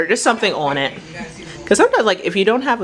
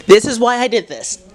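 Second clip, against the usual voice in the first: higher-pitched, quick 'This is why I did this'; lively declaration; 5 semitones higher, 4 dB louder than usual. Real, not acted. First take of emphatic repeat.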